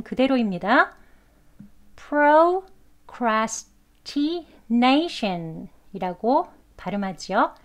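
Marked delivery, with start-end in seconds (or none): 2.0-5.7 procrastination very slowly, full "proh" and "tee"; by phonetic symbols. Shown as a demonstration. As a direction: sound out every vowel of procrastination in full. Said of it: "발음 기호로 같이 지금 저희가 배운 그 발음". (procrastination을 발음 기호 그대로 또박또박)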